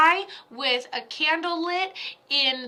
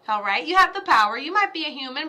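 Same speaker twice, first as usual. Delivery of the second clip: usual speaking voice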